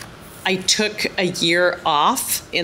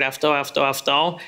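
imitating Greek villagers. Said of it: "they kept saying this word".

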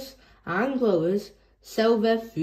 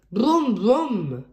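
tractor noise; 3 semitones higher, 4 dB louder than usual. A narrator's reading. George imitating a tractor.

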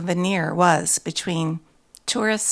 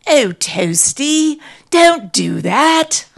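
slow, loud British owner's call; 8 semitones higher, 8 dB louder, much slower than usual. A narrator's reading. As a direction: call out to the dog, drawing it out. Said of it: "calling out".

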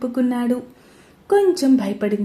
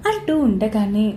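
usual speaking voice

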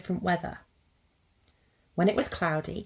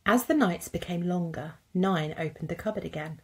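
usual speaking voice

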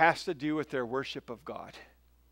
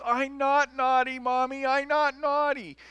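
put-on high tearful child's voice; sobbing little girl; 9 semitones higher than usual. Little sister protesting to mom through tears, high child voice. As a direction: wail it like a hurt little girl. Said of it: "She starts sobbing".

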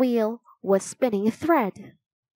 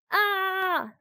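high, drawn-out scream at contained volume; frightened yell; 10 semitones higher than usual. A narrator's reading. Jack flees the floating bone, frightened yell. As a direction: hold a high "Ah" of alarm. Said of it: "yelled Jack".

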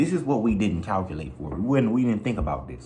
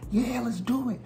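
much higher, eager mock hype; imitating hyped friends. Friends hyping the cliff jump, high eager mock cheer. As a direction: pump up the group like everyone is ready. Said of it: "if everybody vibe like".

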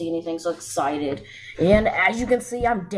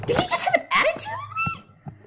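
shrill disbelief, much higher; indignant high accusation. Acted. Girl confronting her rival, sharply indignant and high. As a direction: pitch it way up in outrage.

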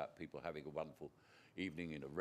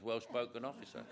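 ordinary talk

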